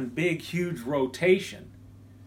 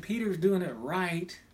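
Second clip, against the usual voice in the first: ordinary talk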